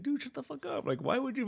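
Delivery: high, laughing mock outburst; imitating an annoyed fan shouting at the host; mock exasperated yell. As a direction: yell it at him in fake outrage. Imitating yelling at Hardwick.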